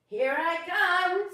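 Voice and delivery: high, loud, slow happy call; happy reply. Acted. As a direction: sing out that you're on your way. Rainbow fish joins happily.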